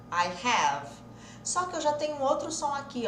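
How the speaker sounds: ordinary delivery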